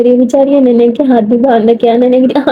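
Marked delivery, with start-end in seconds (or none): none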